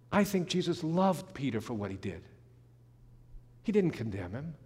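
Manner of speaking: usual speaking voice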